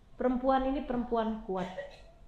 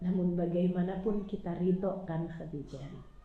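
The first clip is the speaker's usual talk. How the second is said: tender comfort; 7 semitones lower, 10 dB quieter than usual. Acted. Prophet comforts grieving wife.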